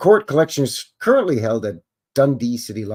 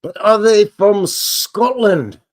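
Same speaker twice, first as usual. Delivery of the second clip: slow, higher, louder mock Scottish accent; a deliberately awful Scottish accent, drawn out; terrible Scottish accent; 9 semitones higher, 7 dB louder, slower than usual. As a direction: ham up a bad Scottish accent as a joke. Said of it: "That's a terrible Scottish accent".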